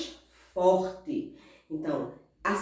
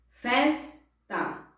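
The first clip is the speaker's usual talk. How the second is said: "festa" split as FES, ta; syllable by syllable; 3 semitones higher than usual. Shown as a demonstration. Teacher gives paroxytone example, syllable by syllable. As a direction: break "festa" in two, strong fes. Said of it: "vamos para os exemplos de paroxítona".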